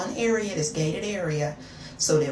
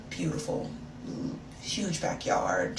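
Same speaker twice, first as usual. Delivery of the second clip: ordinary talk, nothing marked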